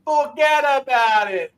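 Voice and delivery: forget about it drawn out high and slow; slow thick New York catchphrase; New York accent. Shown as a demonstration. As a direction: ham up the Brooklyn way. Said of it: "you gotta say it right".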